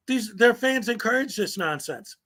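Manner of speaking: ordinary talk, nothing marked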